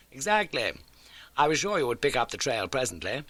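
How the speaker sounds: crisp, keen detective laying out facts; Holmes voice. Acted. Holmes briskly telling Watson of the old case.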